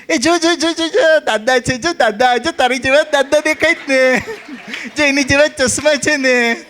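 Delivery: put-on high sing-song coaxing a toddler; woman soothing a child. Mother coaxing a fallen child in the passport queue.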